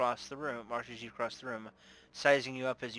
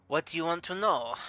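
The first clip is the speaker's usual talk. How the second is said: put-on higher, louder Russian-accented question; 5 semitones higher, 5 dB louder than usual. Bar owner agreeing to talk, Russian accent. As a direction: offer help in a Russian accent. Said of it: "I'm doing a Russian accent".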